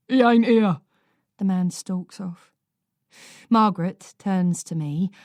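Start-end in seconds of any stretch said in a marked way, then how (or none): 0.1-0.8 blunt loud brush-off; old man shutting the visitor out; curt dismissal. A narrator's reading.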